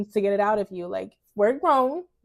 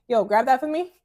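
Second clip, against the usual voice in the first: louder, higher blunt order; impolite slangy demand; 4 semitones higher, 5 dB louder than usual. Shown as a demonstration. Woman telling someone to grab something, loud and impolite. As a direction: bark it casually, no manners. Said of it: "learn to use polite language".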